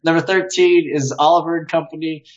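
ordinary talk, nothing marked

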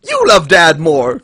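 put-on loud, high childish accusation; hammed-up bickering shout; mock argument. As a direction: fling it at a sibling, loudly. Acted argument between kids.

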